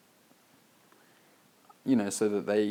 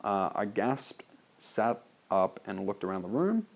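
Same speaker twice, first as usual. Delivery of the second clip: ordinary talk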